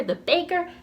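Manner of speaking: plain reading voice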